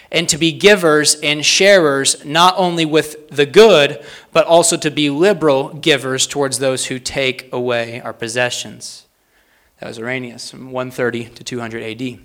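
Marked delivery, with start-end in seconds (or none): 0.1-9.0 slow emphatic phrases, easing at the end; slow, deliberate reading. Real, not acted. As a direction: spell out each phrase slowly and firmly. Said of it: "read these slowly and succinctly".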